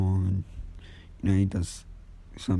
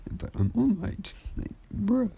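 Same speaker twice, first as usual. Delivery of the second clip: much higher, slower mock-offended hero; wounded pride; 14 semitones higher, slower than usual. All Might, snubbed by the boy, mock-offended and squeaky. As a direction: squeak it out like a hurt celebrity. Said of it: "All Might's pride kinda hurt".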